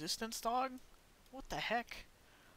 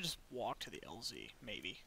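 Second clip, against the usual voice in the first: whispering; 7 semitones lower, 10 dB quieter than usual. Real, not acted. Sneaking past enemies.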